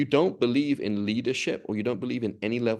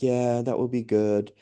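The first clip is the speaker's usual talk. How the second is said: slow, content mock husband; a hammed-up lazy husband liking the idea; pleased lazy husband; slower than usual. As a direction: drawl it like he's delighted to get out of work. Husband voiced in his own story.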